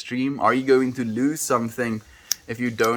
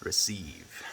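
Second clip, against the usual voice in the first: a soft unvoiced whisper; whispered, slow; 6 semitones lower, 10 dB quieter than usual. Real, not acted. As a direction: breathe the word out gently.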